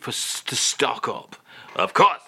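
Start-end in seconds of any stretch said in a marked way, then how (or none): 1.8-2.2 louder, higher mock-French exclamation. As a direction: agree emphatically as a Frenchman. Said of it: "[name]. Are you".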